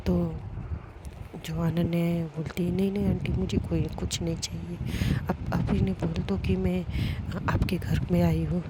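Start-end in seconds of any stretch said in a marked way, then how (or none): 2.7-8.6 soft plea. Acted. Pleading refusal.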